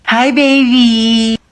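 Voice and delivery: put-on baby talk. Greeting the baby.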